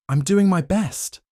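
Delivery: high, strained, nervous reply; nervous stammer. A narrator's reading. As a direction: stammer it out under pressure. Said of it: "Claire stammered".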